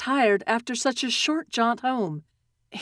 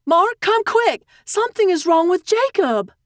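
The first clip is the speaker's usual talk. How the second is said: high, loud cry for help; alarmed mother calling her husband; urgent calling out; 8 semitones higher, 4 dB louder than usual. A narrator's reading. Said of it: "His mom called out for his dad".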